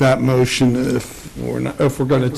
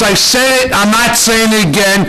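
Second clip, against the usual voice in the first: fed up, voice pitched up high; exasperated raised voice; 7 semitones higher than usual. Real, not acted. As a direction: snap back, clearly losing patience. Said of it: "I would appreciate if you would do so as well".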